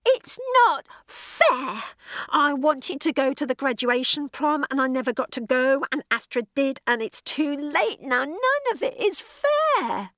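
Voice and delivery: put-on high, loud, furious teenager; a sulky teen raging at unfairness; furious teenage rant. A narrator's reading. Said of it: "this time it was furious".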